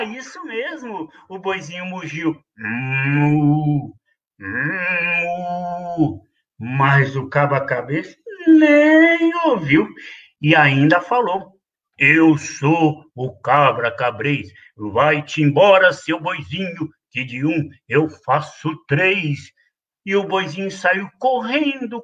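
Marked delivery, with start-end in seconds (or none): 2.6-6.2 low drawn-out moo. A narrator's reading. Ox answering the children, low moo. Ox moos for the kids. 12.0-19.5 low, slow, gruff goat threat; menacing billy goat. Acted. Bully goat chasing the kid away, slow low threat.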